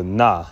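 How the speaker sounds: 'na' with n first, open ah after; feminine suffix. Shown as a demonstration. Teacher swaps ma for feminine, sounded as 'na'. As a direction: say 'na' ending on the open vowel. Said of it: "instead of a ma".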